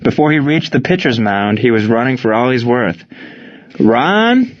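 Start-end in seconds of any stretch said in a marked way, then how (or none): 3.8-4.5 high questioning call, sliding upward; searching, raised-pitch yell. A narrator's reading. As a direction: call out high for a missing friend. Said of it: "Gene yelled".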